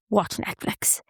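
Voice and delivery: half-whispered, trailing off, worn out; tired whisper. Retold or imitated. Knackered on a Monday evening, wishing for Netflix, in a tired whisper. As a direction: sigh it out like you're too knackered to care.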